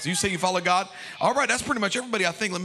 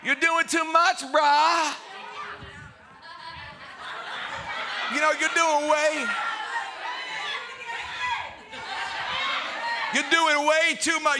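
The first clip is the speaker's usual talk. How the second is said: frat bro; 3 semitones higher than usual. Retold or imitated. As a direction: call him out like a bro. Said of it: "It's like".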